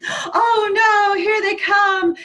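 hammed-up alarm as the lemmings come; panicked grandma; mock panic.

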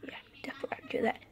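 quick whispered agreement; fast whisper. Acted. Fighter reassuring himself at night, fast whisper. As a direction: rush it out in a whisper. Hushed self-talk.

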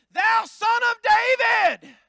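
high, loud, slow declaration; reverent shout. Acted. Bartimaeus hailing Jesus as Messiah, a high slow shout. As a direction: proclaim him with full respect. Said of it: "But he said".

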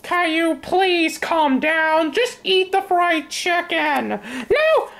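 ordinary talk, nothing marked

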